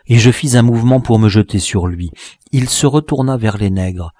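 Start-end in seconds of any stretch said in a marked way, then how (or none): none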